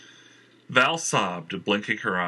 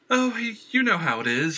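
higher, stammering and nervous; shaky voice; 12 semitones higher, 4 dB louder than usual. Acted. Valerie answers Jenner, nervous and shaky. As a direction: hide the fear behind a shaky laugh. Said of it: "Her voice shook".

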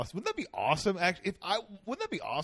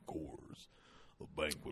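Sam Elliott voice; 12 dB quieter than usual. Retold or imitated. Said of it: "guy's name from the Coors commercials".

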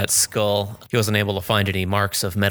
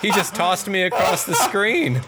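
laughing; 11 semitones higher, 3 dB louder, slower than usual. Real, not acted. Tossed across screen, laughing.

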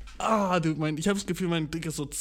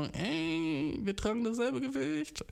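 higher, softer girlish mock; 9 semitones higher, 5 dB quieter, slower than usual. A girl spotting her double, said in a high soft mock voice. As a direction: put on a breezy girl talking to a twin. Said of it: "bist du nicht auch so".

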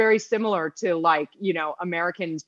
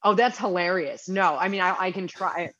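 ordinary talk

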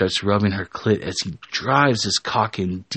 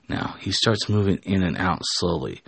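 ordinary delivery

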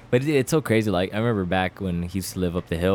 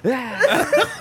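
laughing yelp. Retold or imitated. Voicing John's yelp mid-laugh.

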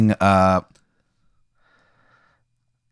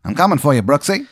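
put-on high old-time gumshoe threat; hammed-up movie detective, pitch leaping at the end; 1920s detective; 5 semitones higher than usual. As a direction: warn the suspect in a twenties private-eye voice. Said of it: "he talks like one too".

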